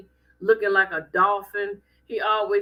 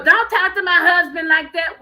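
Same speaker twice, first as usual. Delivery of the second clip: higher, louder imitated scolding; indignant wife; 6 semitones higher, 6 dB louder than usual. Acts wife snapping at friend.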